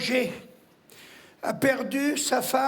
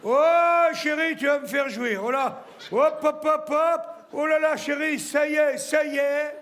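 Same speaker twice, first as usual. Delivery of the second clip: man about to climax; 3 semitones higher than usual. Acted. Comedian shows the audience a man climaxing, hammed-up ecstasy. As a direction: play an over-the-top climax, panting out exclamations. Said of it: "je vais vous le faire, l'homme qui va jouir".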